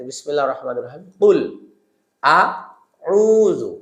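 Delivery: a light recitation lilt; Qur'an recitation. Acted. Teacher recites Surah Falaq for students, light recitation. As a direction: recite it gently.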